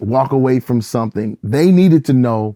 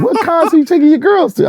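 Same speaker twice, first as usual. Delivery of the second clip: very high, loud outraged question; heated disbelief; 5 dB louder than usual. Retold or imitated. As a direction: mimic an upset pastor's incredulous squawk. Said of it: "He was very, very heated, upset".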